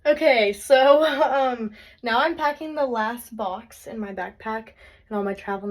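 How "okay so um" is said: normal talking voice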